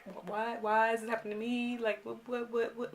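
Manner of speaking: quiet retold stuttering; confused stutter. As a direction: stumble helplessly over the words. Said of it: "Like, I'm stuttering".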